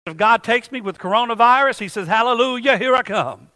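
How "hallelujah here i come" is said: ordinary delivery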